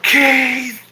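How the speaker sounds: put-on loud, high drunken holler; imitated event-crowd yell; top-of-lungs yell. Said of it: "yelling at the top of my lungs like a drunk".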